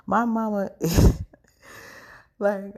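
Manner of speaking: a laugh breaking through as she says it. Real, not acted. Telling viewers she called her mama, laughing.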